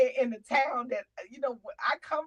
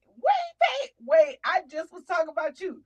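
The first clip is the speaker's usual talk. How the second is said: sudden high, loud "wait, wait, wait"; cutting yourself off with a loud high burst; excited call-out; 6 semitones higher, 9 dB louder than usual. Real, not acted. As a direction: jump in excitedly to stop the story. Stops to call out to friend.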